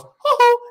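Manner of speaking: high-pitched, loud "hoo-hoo" whoop; wild whoop. Retold or imitated. Recounting being out of his mind, a high loud whoop. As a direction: whoop it high like you've lost it.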